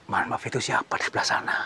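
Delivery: soft breathy question; whisper. Real, not acted. Host whispers asking who is over there. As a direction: whisper so the spirit is not disturbed.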